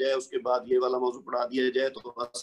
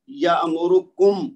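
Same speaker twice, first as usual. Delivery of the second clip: slow یامرکم, landing loud on کم with a closed meem; emphasis on KUM; 6 dB louder, much slower than usual. Shown as a demonstration. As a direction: stretch یامرکم and punch the final کم. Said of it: "اب کم میں میم جو ساکن ہے".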